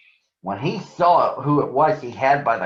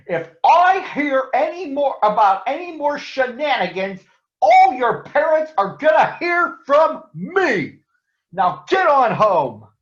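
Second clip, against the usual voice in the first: scolding father; 13 semitones higher, 5 dB louder than usual. Acted. Big father scolding the gang, loud angry warning. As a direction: threaten the kids, then send them off.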